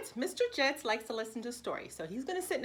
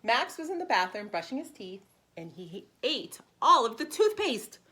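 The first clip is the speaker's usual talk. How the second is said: bright, lively read-aloud for preschoolers; animated picture-book narration; storybook reading; 7 dB louder than usual. Acted. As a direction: read it like story time for little kids. Teacher reads picture book aloud.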